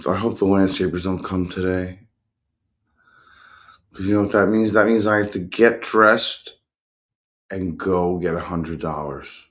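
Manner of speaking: usual speaking voice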